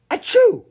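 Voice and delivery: loud, very high-pitched mock sneeze; sudden high achoo, startlingly loud; acted sneeze. A narrator's reading. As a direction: burst out with a squeaky pretend sneeze. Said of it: "Ruskin sneezed".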